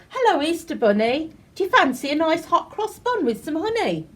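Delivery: chirpy fairy greeting her friend; cheerful fairy, lightly voiced; lightly voiced Tooth Fairy.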